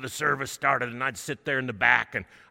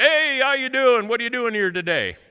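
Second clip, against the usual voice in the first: big hearty voice, much louder; loud booming man; 11 semitones higher, 11 dB louder than usual. Retold or imitated. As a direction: call out loudly like he's hard of hearing. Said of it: "he speaks very loudly".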